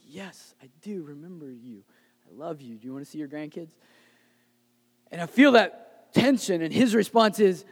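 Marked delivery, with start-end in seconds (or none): 0.0-3.8 very soft acted reply; quiet, placating son. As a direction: humor your mother in a hushed voice. Patient son answering his mother.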